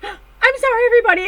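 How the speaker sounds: high, loud giddy apology; mock-shrieked sorry; excited apology. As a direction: apologize to the room while bursting. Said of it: "She's like".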